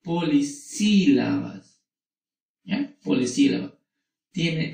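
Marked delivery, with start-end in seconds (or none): 0.0-1.8 polisílabas said slowly, each syllable clear; slowly spelled out. Shown as a demonstration. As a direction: draw out polisílabas for the class. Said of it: "la vamos a llamar poli".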